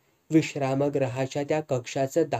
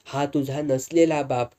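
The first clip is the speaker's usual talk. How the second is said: ordinary delivery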